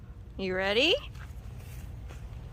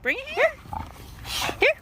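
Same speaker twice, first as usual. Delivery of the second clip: high dog call; 10 semitones higher, 5 dB quieter than usual. Real, not acted. Calling the dog.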